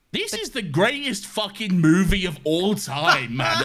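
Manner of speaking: high, incredulous, over-the-top rave; gushing. Real, not acted. Film fan gushing to co-hosts about the movie, wildly excited. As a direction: rave about it, drawing out the praise.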